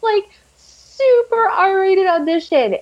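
ordinary talk, nothing marked

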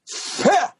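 put-on very high, short old man's huff; high-pitched mock scoff; imitated scornful huff. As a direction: mimic a dismissive old man's grunt.

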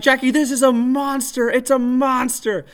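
freaking out. Retold or imitated. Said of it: "I started freaking out".

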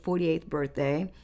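ordinary talk, nothing marked

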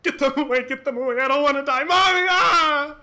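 loud, high panicked pleading; terrified screaming. Acted. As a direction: scream for help in terror. Screaming at ghost.

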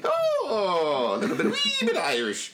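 put-on high, wobbly Irish accent that sounds like a witch; a hammed-up Irish brogue that slides into a witch cackle; bad Irish accent. Cohost hams a failed Irish accent, high and witchy. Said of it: "That was my Irish accent".

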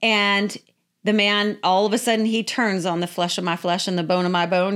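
usual speaking voice

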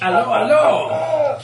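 high, loud mock-French accent; comic French accent. Shown as a demonstration. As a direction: lay on a hammy French accent, stretched out. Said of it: "French is easy. It's all about being nasal".

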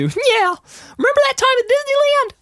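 very high squeaky cartoon mouse; Mickey Mouse voice. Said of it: "Hi, Mickey".

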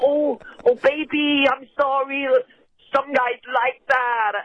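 put-on high, slow, coy Greek accent; mock Greek accent. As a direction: play the Greek escort apologising sweetly in a Greek accent. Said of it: "imagine it in whatever a Greek accent was".

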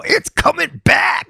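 high, slow hammed-up yelling; mock yell. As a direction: shout it out, drawn and high. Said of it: "You were yelling about it".

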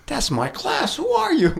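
a delighted shout of recognition; amazed realization. Retold or imitated. The executive realizing his bus mate was a classmate, shouting in surprise.

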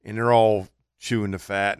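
usual speaking voice

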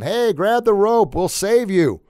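high, loud retold rescue call; yelling down. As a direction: shout from a helicopter to someone below. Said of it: "and they yell down".

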